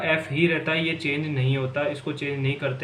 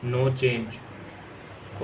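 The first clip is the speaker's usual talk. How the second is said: "no change" repeated much softer as a reminder; 3 semitones lower, 7 dB quieter than usual. Shown as a demonstration. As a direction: drop to a low, soft echo of "no change". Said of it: "इसमें भी no change रहेगा". (धीमी आवाज़ में दोहराना)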